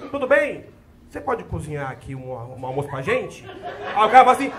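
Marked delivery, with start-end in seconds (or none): 0.1-3.5 softer, much lower, mock-gentle asking; polite request. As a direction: ask sweetly, the way they never did. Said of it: "Não, nunca assim, ó".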